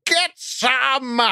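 high, hammed-up German-accented yell; mock scream. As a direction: yell it out as a cartoonish German coach.

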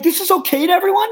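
high, fast indignant appeal; retold yelling. Traveler to a line full of people ignoring cutters, mock yelling. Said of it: "I'm just start yelling at him".

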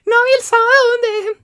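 put-on very high, loud, slow whiny baby voice; baby talk. Guy imitating himself asking boyfriend about Saturday, baby whine. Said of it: "yo hablo como un bebé".

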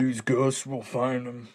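slow tough dude-bro ghost hunter; mock macho bro voice; Zach Bagans impression. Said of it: "the dude bro Zach Bagans where I'm like".

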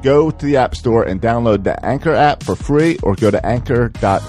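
upbeat podcast ad read. Acted. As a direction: read it like a radio ad. Podcast ad read over music.

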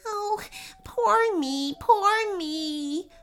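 high, self-pitying little chipmunk; mock wailing. A narrator's reading. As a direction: wail woefully in a high voice. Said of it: "wailed the chipmunk".

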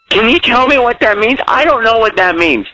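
ordinary talk, nothing marked

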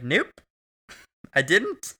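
lightly acted high-pitched fib; mock-innocent denial. Retold denial to the waiter.

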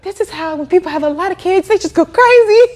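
high, laughing exasperation at herself; half laughing in mock despair; laughing disbelief. Assistant teacher overwhelmed by three-year-olds, high laughing exasperation. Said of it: "I was like".

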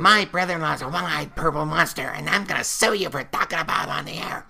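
high, loud outraged complainer voice; angry caller. Acted. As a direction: play an irate caller threatening to sue. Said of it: "mad and angry and ugly".

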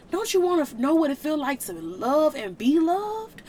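rising sermon plea, full of urgency; impassioned preaching. Real, not acted. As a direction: preach it, begging them to let go of the hurt. Pleading with listeners about God.

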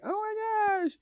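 put-on high, breathy-free excited girlfriend squeal. Partner mimicking girlfriend coming home, high excited squeal. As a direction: squeal it like she's thrilled. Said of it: "She's like".

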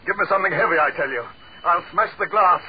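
screaming, very high and loud; wild screamed threat, voice at breaking pitch; hysterical panic. Acted. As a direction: shriek in desperate, unravelling terror. Hysterical threat to smash the glass.

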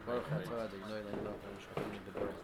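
low, rapid murmured prayer; murmured blessing. Real, not acted. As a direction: rattle off the blessing softly, under the breath. Reciting a blessing in passing.

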